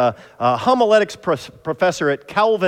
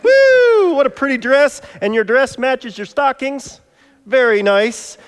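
excited cheer; 8 semitones higher, 6 dB louder than usual. Real, not acted. Preacher welcomes child Della to the stage, excited cheering. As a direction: hype her up like a game show host.

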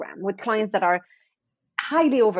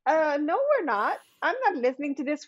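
mock inner personal assistant; 6 semitones higher, 3 dB quieter than usual. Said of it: "their personal assistant's like".

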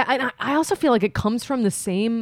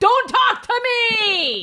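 high, slow, drawn-out mock shout; mock yell; 14 semitones higher, much slower than usual. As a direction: snap back hammed-up, stretching it out. Acted caught cheater snapping.

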